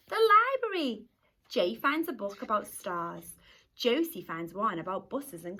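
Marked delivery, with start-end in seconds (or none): 0.1-1.1 excited reveal. Acted. Reveal answer to listeners.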